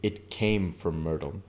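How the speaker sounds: ordinary delivery, nothing marked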